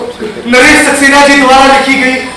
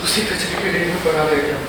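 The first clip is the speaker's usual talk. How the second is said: very soft, low murmur; desolate echo; 8 semitones lower, 13 dB quieter than usual. Acted. Discarded artist repeats his fate to himself, desolate hushed echo. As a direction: let it sink down, almost to nothing.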